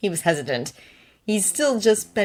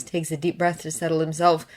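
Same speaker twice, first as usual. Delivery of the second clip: plain reading voice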